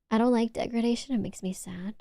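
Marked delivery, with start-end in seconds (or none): 1.1-1.9 soft whisper, much softer. Shown as a demonstration. Whispering to chat that degradation makes her sad. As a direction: hush it out sadly in a whisper. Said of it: "this kind of tone".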